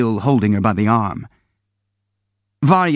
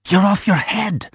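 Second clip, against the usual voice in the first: ordinary delivery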